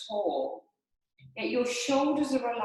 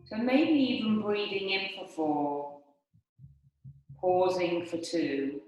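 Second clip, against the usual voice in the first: lower, unhurried breathing-meditation voice; guided meditation; 3 semitones lower than usual. Acted. Yoga teacher leading the class through breathing, guided meditation. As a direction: guide them calmly through each breath, leaving long pauses.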